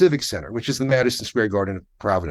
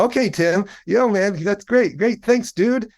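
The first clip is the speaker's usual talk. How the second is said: higher-pitched, mildly hammed-up gratitude; pitched-up, eager thanks; effusive thanks; 7 semitones higher than usual. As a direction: retell a grateful dude thanking a stranger. Retold delighted thanks for free tickets.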